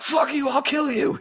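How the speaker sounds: high, loud mock road rage scream; road rage yell. Driver cut off in traffic, screaming high and loud.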